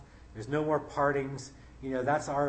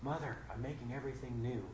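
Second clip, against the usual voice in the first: Jesus' line, softer and gentle; quiet tender; 8 dB quieter than usual. Acted. Jesus on the ground speaking to Mary, soft and tender. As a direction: say it softly to his grieving mother. He turns his head to the side and says.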